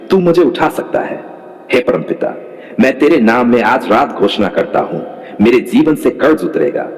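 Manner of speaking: ordinary talk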